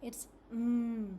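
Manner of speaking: a held nasal "mm" hum; isolated m sound. Shown as a demonstration. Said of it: "The next sound is mm".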